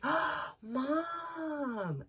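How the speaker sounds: put-on high, small child's cry of surprise; little boy voice. Son noticing something from the car window, high childlike voice. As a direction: mimic the little boy gasping.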